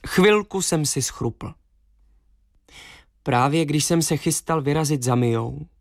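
ordinary delivery, nothing marked

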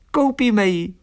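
high, eager little swallow; enthusiastic. A narrator's reading. Swallow agrees to buy a bed, high and enthusiastic. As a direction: agree at once in a high, excited voice. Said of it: "nadšeně souhlasila Eliška".

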